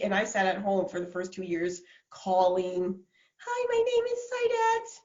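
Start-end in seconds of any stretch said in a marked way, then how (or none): 3.4-5.0 tiny, very high squeak of a timid cold caller; imitating own timid, high-pitched sales call; mousy phone voice. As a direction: squeak it out like a nervous mouse. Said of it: "I literally sound like a mouse".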